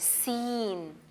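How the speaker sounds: सीन with a long drawn-out ee; softly stretched सीन; dragged ee. Shown as a demonstration. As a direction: drag the ई in सीन. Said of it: "ई की sound को drag करेंगे".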